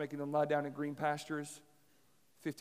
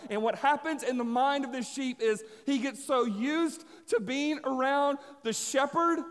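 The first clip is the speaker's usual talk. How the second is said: loud, driving sermon voice, pitched high; fired-up preacher pushing the climax home; preaching at full force; 7 semitones higher, 6 dB louder than usual. Acted. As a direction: build the story like a revival preacher. Preaching builds again.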